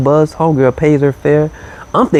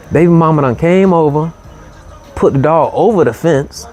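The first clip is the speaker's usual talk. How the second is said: normal talking voice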